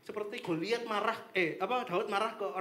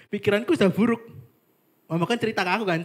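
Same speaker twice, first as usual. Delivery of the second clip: much louder, animated; loud excited telling; 2 semitones higher, 12 dB louder, faster than usual. Real, not acted. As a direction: tell it loud and worked up. (bercerita keras dan bersemangat)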